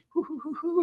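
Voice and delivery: very high, soft breathy giggle like a cartoon schoolgirl; anime schoolgirl giggle. Priest mocking himself to the interviewer, anime schoolgirl giggle. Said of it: "he starts pretending he's like an anime schoolgirl".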